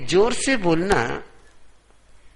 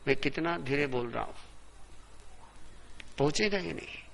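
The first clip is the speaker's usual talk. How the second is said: much softer, as if stepped back from the microphone; off the mic; 12 dB quieter than usual. Shown as a demonstration. Preacher showing that his voice will not carry, quiet off-mic speech. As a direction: turn away from the mic and speak quietly. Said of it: "इतना सा भी mic से हट गया".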